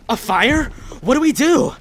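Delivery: high, loud frightened cry; panicked. Acted. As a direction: ask in rising panic. Fire breaking out.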